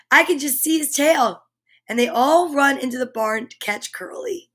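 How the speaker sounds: bright, lively storybook read-aloud; animated picture-book narration; reading to children. A narrator's reading. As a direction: read it like story time for little ones. Reads picture book to kids.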